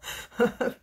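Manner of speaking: short rueful laugh; breathless little burst of laughter; laugh at oneself. Real, not acted. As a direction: laugh off your own mishap. Laughing at her own mishap.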